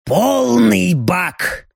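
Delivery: very slow, sated demon drawl; a full, lazy demon. Acted.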